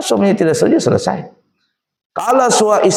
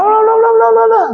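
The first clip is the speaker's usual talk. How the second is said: high, louder la-la babble of a scolding; mocking wife's nagging; 4 dB louder than usual. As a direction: mimic endless nagging as nonsense sing-song. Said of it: "Istrinya ngomel".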